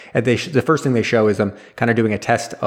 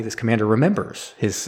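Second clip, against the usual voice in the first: usual speaking voice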